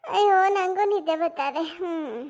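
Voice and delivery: slow, sleepy sigh into the words; drowsy yawn. Acted. Wife giving up for the night, slow drowsy yawn. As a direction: let the yawn drag ಅಯ್ಯೋ out.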